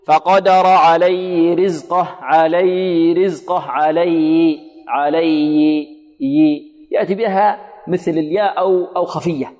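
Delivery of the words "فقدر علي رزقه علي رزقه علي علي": فقدر علي رزقه read slowly with the ه of عليه swallowed into علي; weak ha imitation. Shown as a demonstration. Teacher imitating students who hide the ha. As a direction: drop the ه so عليه sounds like علي. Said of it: "فيقول".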